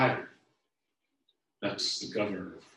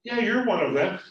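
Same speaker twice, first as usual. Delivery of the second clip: accusing man; 12 semitones higher, 10 dB louder than usual. Acted.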